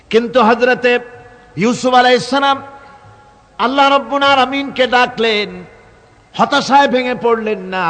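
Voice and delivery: high declamation in separated phrases; impassioned preaching. Real, not acted. Preacher tells crowd Yusuf called on Allah, impassioned preaching. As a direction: proclaim each phrase to the crowd, then pause.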